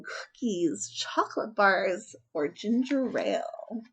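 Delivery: usual speaking voice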